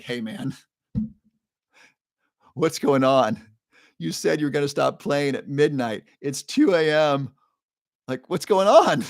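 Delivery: laughing disbelief rising in pitch; polite but rising incredulity; incredulous. Retold or imitated. Confronting the DJ at two AM, rising disbelief.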